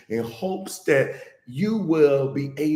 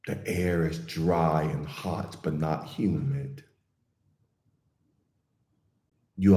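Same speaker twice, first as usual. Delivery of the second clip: guided meditation; 6 semitones lower than usual. Real, not acted. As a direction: ease listeners gently into calm, unhurried and even.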